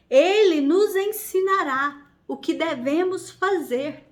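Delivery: measured, declamatory Bible reading with firm emphasis; ringing pulpit reading voice; solemn scripture reading. Real, not acted. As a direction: proclaim the passage phrase by phrase, with pauses. Reads scripture aloud.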